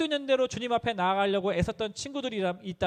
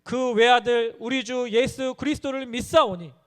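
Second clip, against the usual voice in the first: higher, louder and slow, 외아들 held and falling; fervent creed recital; 5 semitones higher, 7 dB louder, slower than usual. Acted. Preacher proclaiming the Apostles' Creed, slow and loud. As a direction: proclaim 외아들 slowly at full force.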